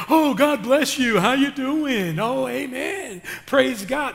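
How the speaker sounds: high, loud, gushing put-on voice; fake church greeting. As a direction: gush like a phony churchgoer in the supermarket. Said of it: "That's not how I talk".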